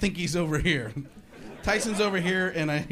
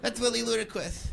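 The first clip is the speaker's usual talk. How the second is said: soft lisping boxer voice; Mike Tyson; 5 semitones higher, 4 dB quieter than usual. Acted. Reacting to the funny voice, soft lisping impression. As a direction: lisp it softly. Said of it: "even Mike Tyson's going".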